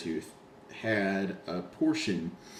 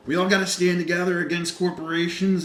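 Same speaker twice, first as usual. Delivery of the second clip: loud, high rallying cry, imitated; mock a singer preaching to the crowd; hammed-up speech from the stage; mock stage rant; 7 semitones higher, 7 dB louder than usual. Mimics singer's onstage political speech.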